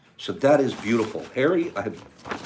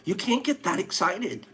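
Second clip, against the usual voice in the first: retold scolding; 11 semitones higher than usual. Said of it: "I'm like".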